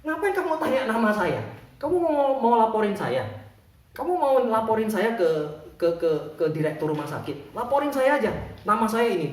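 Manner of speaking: high, loud indignant challenge; angrier. Acted. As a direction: challenge them as the furious janitor. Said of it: "bapak yang ngepel ini tambah marah".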